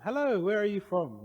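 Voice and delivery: high, sing-out friendly hello; retold janitor greeting, pitched well up; cheerful janitor greeting. Janitor greets my friend at Disneyland, bright high welcome.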